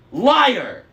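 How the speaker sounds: furious shout. A narrator's reading. Annabeth screaming at Luke, furious. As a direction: scream it at him.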